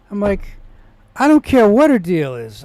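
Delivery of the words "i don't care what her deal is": higher, louder retelling of a fed-up reply; exasperated retort. Retold or imitated. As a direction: cut off the excuses with irritation. Acted angry reply to the nurse.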